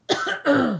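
sudden dry cough; harsh cough. Real, not acted. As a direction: cough out hard from a sore throat.

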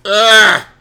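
loud strained gasp after coughing; throat-clearing gasp. Real, not acted. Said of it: "are you choking on the water".

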